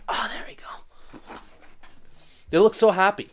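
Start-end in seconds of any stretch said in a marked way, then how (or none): 0.1-1.3 whispering. Real, not acted. Whispered aside.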